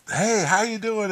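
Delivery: high, drawn-out woman's hello; mock her chirpy, slow greeting in a high voice; imitated friendly greeting. Imitating the woman greeting the drug boys, high and friendly.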